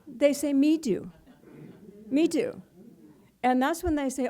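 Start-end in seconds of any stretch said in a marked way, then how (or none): 0.5-2.6 put-on a toddler's stubborn little demand, louder; insistent toddler. Preacher voicing a toddler who refuses help, loud and insistent. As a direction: play the small child who wants to do it alone.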